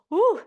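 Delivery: loud, high whoop of encouragement; excited woo. Real, not acted. Instructor cheers the class through the last rep, loud woo. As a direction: whoop to fire everyone up.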